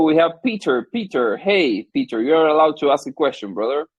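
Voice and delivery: normal talking voice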